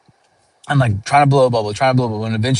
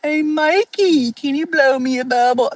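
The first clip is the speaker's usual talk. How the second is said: mock pleading kid. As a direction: beg in a squeaky mocking voice. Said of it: "If they were like".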